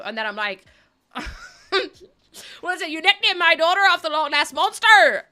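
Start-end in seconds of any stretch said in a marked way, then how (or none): 2.6-5.2 a loud, hammed-up Irish farmer accent. Streamer voicing an angry Irish farmer dad, hammed-up farmer accent. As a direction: bark it out like an Irish farmer, higher and louder. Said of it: "I put on a proper farmer accent".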